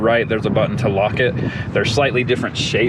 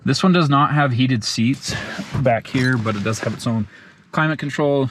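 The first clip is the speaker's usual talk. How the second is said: ordinary delivery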